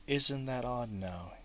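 ordinary delivery

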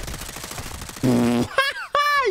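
laughter leaping up high; incredulous high laugh; high-pitched laugh. Real, not acted. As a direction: burst into a high cackle. Laughs at the enemy's weapon.

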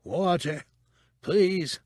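high, husky begging; ghastly husk. A narrator's reading. Tortured man pleads with Cabe for water, husky begging. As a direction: beg weakly through a parched throat. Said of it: "pleaded in a ghastly husk of a voice".

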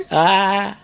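drawn-out sobbed answer; mock tearful child; crying child. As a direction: imitate a small kid blubbering. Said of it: "નાનું છોકરું સીડીએથી રડતું રડતું".

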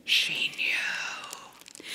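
drawn-out, hushed storyteller whisper; dread-filled whisper. A narrator's reading. Child's dread after father's cry.

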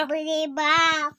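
loud, dragged-out mock complaint; child loudly mimicking mom's sigh; hammed-up whine. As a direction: ham up the fuss. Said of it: "অত ভ্যাকা করতে হবে না".

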